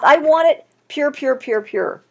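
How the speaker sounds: preacher voicing God, fading on pure; repeated pure. Voicing God's demand.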